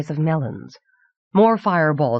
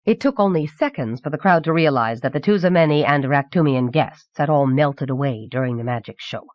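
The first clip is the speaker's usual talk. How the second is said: usual speaking voice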